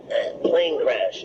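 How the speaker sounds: slow, strained call through chest pain; strained weak yell. Retold or imitated. Survivor calling weakly to the farmer, slow and strained. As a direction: push the words out slowly past a hurt chest. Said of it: "I couldn't yell too loud because my chest".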